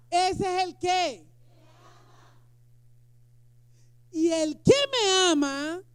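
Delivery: slow, loud proclamation; preaching shout. Real, not acted. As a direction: thunder it from the pulpit. Predica a gritos. (proclamación lenta y fuerte)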